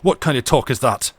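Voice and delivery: angry outburst. Acted. Captain rounding on the mate in hot anger. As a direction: flash at him in anger.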